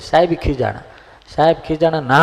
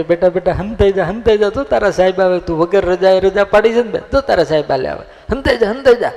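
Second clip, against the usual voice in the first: higher, louder fussy grandfather voice; an old man hurrying the boy to hide; a grandfather pleading with the boy to hide from the teacher, high and urgent; urging grandfather; 6 semitones higher, 3 dB louder, faster than usual. Retold or imitated.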